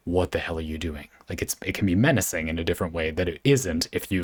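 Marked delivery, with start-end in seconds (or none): 0.1-1.1 low and soft, hell said flat and even; really calm. Shown as a demonstration. Demoing the calm, natural way to swear, quiet and even. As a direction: say it calmly, quietly menacing. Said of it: "if you say it in a really calm way".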